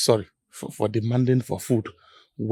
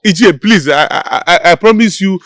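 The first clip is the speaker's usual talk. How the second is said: desperate loud pleading; 2 semitones higher, 10 dB louder than usual. Acted. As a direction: plead like your job hangs on it. Desperate plea to keep job.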